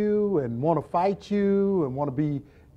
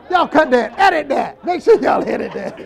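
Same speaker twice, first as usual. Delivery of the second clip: loud laughing plea; 4 semitones higher, 5 dB louder than usual. Real, not acted. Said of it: "asking crew to cut it".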